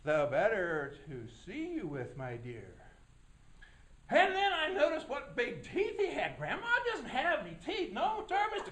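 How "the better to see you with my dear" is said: much lower wolf voice, sly; low, sly wolf voice teasing the girl; big bad wolf. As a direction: growl it low like the wolf in bed. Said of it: "And he said".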